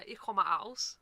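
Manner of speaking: slow, careful beginner's German; speaking German. Shown as a demonstration. Speaker showing her small talent, slow careful German. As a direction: show off a little German, slowly. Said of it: "I can speak a little bit German".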